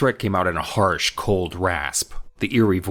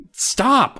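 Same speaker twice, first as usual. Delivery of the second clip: frightened cry; 4 dB louder than usual. Acted. Amy struggling against dummy.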